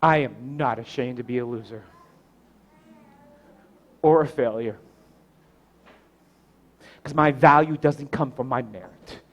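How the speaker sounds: low, soft, measured declaration; preach, solemn. Real, not acted. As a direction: confess it quietly, with long pauses.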